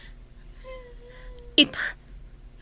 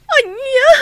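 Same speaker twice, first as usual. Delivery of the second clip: a sharp sobbing cry, much higher and louder; 12 semitones higher, 8 dB louder than usual. Acted. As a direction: cry out in shock and grief. News of father's death, sister breaks. (agsangsangit nga agpukkaw)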